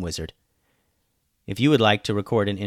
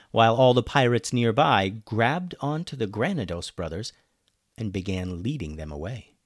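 plain reading voice

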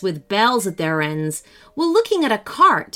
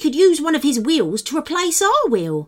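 higher, eager little gnome; cheerful gnome; 6 semitones higher than usual. A narrator's reading. Barnabas proposing to borrow a wheel, cheerful. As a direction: pitch the bright idea.

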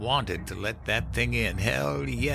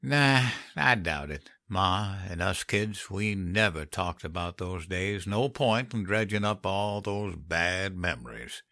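put-on unhurried old man's drawl; drawn-out, dropping in pitch after the first words; 3 dB quieter, slower than usual. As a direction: dismiss it lazily, stretching the words. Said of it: "Henry cocked his head and drawled".

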